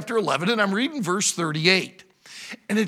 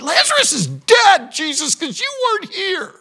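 high, loud cry of accusation; acted outburst, pitched way up; upset reproach; 10 semitones higher, 7 dB louder than usual. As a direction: shout it as a grieving sister blaming him. Acts Martha reproaching Jesus.

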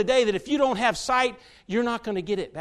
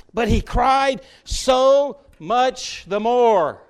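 so much the more, drawn out slowly and leaned on; slow emphasis; 3 semitones higher, 7 dB louder, much slower than usual. Shown as a demonstration. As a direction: stretch "so much the more" word by word, pressing "so". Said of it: "slow emphatic scripture read".

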